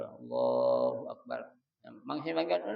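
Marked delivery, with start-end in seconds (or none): 0.0-1.4 slow, drawn-out Allahu Akbar at normal volume; measured opening of prayer; prayer takbir. Shown as a demonstration. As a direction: intone the takbir slowly and low. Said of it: "panggilan Allah tutupin dulu".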